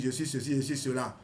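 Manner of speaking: ordinary delivery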